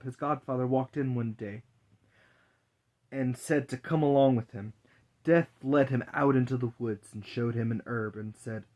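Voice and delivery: usual speaking voice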